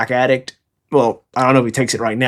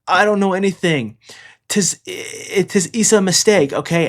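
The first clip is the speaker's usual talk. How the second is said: higher-pitched, halting mock plea; mock pleading; 6 semitones higher than usual. Beaten child denies all to the Marine, mock pleading. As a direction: stammer out a put-on denial.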